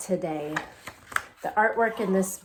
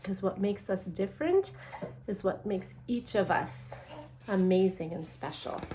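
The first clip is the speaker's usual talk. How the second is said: ordinary talk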